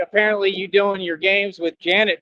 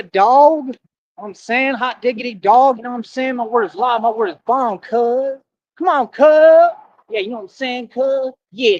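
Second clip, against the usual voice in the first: higher, hammed-up slang persona; exaggerated street talk; 4 semitones higher than usual. Streamer mocking commenters in a hammed-up street slang voice. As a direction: ham it up as a swaggering street dude. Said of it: "Imagine if I talk like that every day".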